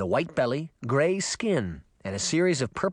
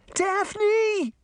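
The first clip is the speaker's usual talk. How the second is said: very high, desperate call; high-pitched panicked call; alarmed cry; 3 dB louder than usual. Acted. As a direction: cry out for a lost sister. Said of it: "Sabrina cried".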